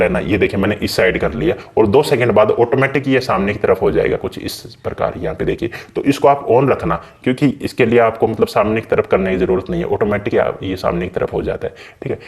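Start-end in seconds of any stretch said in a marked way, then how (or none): none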